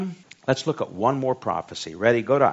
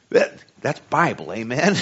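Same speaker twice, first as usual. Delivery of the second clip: ordinary delivery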